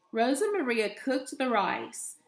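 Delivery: ordinary delivery, nothing marked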